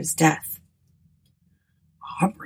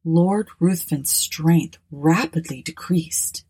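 ordinary delivery

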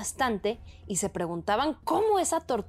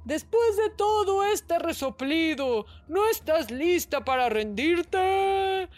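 tortoise calling out; 8 semitones higher, slower than usual. A narrator's reading. Hidden tortoise shouts teasingly to the hippo. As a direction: call out to taunt her rival. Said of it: "mientras gritaba".

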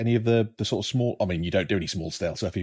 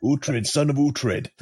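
dramatic hero quote; 5 semitones higher, much slower than usual. Retold or imitated. Quoting the show's hero.

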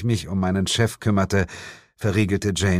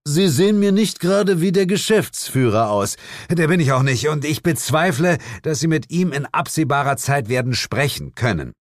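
snobbish man and reply; 6 semitones higher than usual. A narrator's reading. Snobbish man and narrator exchange.

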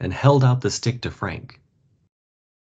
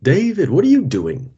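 high, loud, surprised call; startled cry; 9 semitones higher, 5 dB louder than usual. A narrator's reading. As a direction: call out to stop David. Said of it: "cried one of the other men".

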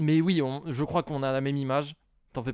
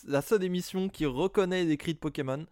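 voix d'animateur; 3 semitones higher, 4 dB louder than usual. Acted. Lance l'émission comme un animateur.

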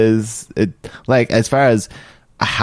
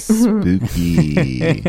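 mock spooky voice; 8 semitones higher than usual.

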